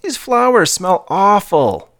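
put-on high, slow, grossed-out kid voice; disgusted kid. A narrator's reading. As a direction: whine about the smell. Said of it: "exclaimed Joey".

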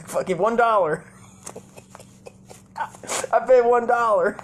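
high, loud game-show bid; mock contestant shout. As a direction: holler the bid like an overexcited contestant.

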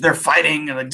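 normal talking voice

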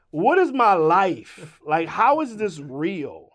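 loud, drawn-out retold bewilderment; slow, loud "can this be happening" reaction; amazed disbelief. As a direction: stretch it out in mock disbelief. Retelling his amazed reaction.

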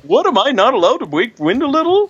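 high, mock Swedish accent; hammy attempt at a Swedish accent. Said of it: "I can't do a Swedish accent".

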